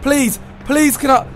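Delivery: ordinary talk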